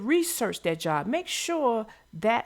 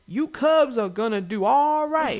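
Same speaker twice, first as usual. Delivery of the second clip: quoting the farmer; 5 dB louder than usual. Retold or imitated. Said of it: "he says towards the end".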